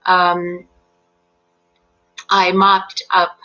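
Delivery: normal talking voice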